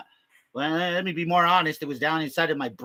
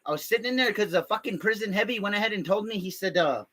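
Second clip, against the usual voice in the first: ordinary talk, nothing marked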